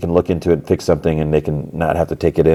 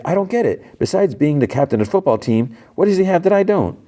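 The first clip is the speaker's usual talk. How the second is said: higher, whiny disbelief, fast at the end; exasperated whine; 11 semitones higher than usual. Retold or imitated. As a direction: complain like a jealous teen. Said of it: "He's like".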